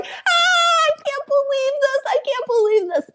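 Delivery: very high, fast, giddy disbelief; squealing thrilled inner voice; inner excitement. Retold or imitated. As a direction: freak out happily inside your head. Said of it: "And inside, I'm going".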